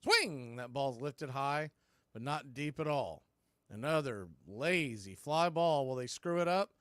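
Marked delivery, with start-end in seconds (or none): none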